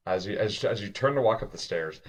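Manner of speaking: ordinary talk, nothing marked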